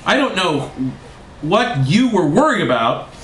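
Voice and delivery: high-pitched hammed-up ranting; theatrical screamed threat, held back; mock scream. A narrator's reading. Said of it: "He screamed all of this".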